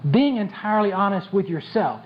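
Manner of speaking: normal talking voice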